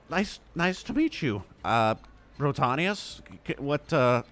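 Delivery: high, halting forced politeness; strained niceness, stumbling over words; holding back anger. Acted. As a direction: squeeze out a pained, polite greeting. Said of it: "he's really trying to hold back, not getting angry".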